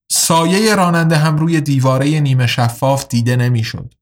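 ordinary delivery

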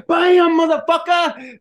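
high, loud drawn-out yell; mock shout. Man reliving the rice cooker smash, loud slow yell. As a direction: belt it out like the crash just hit.

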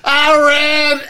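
high loud cry of getting the joke; laughing shout. Real, not acted. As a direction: burst out laughing.

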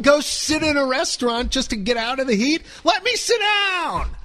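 park guest ranting about the seating rule, outraged shout; exasperated rant. Real, not acted.

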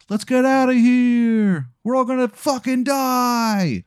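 high, slow drawn-out cry of alarm; mock panic. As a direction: wail it like a hammed-up crowd fleeing. Panicked crowd at fire alarm.

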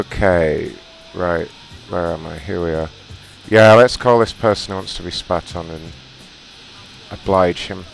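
ordinary talk, nothing marked

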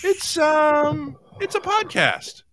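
high, hesitant little explanation; sheepish answer. Retold or imitated. Hosts answering the desk clerk, high and sheepish. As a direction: answer meekly, caught off guard. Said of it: "looked up and went".

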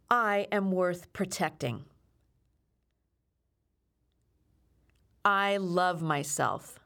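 steady affirmation read; affirmation lead. Acted. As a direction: lead the call and response like a self-defense coach. Leads affirmation call and response.

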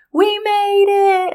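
high, drawn-out mock squeal of victory; triumphant cheer. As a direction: stretch it out high, like bursting in to celebrate. Bursting out of car doors cheering.

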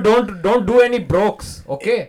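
put-on high Indian-accented villain patter; mock scolding in an Indian accent; Indian Mister Freeze. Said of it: "Indian Mister Freeze doing five minutes".

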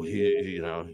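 ordinary talk, nothing marked

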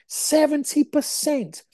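slow, high-pitched disbelief; incredulous emphasis. Real, not acted. Telling viewers the shocking figure, incredulous. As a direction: stretch out "Seventy percent", pitched right up. Repeats figure he finds insane.

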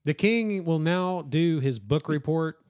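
slow, deliberate classroom introduction; mock announcement. Teacher introduces the King in seventh grade, slow mock announcement. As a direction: announce it with playful ceremony, drawn out.

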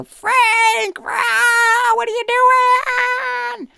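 very high, loud incredulous wail; mock exasperated cry. Mile High staff reacting to Frank's prank, high incredulous wail. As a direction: wail it like a stunned coworker. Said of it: "They'll all go".

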